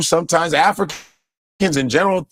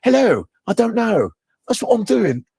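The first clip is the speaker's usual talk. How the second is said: higher, put-on British accent; 4 semitones higher than usual.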